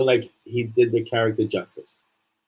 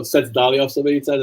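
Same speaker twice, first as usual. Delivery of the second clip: ordinary talk, nothing marked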